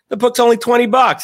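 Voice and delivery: high, loud mock governor quip; quoting the governor. Retelling the governor's joke about the book, high and loud. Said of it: "He goes".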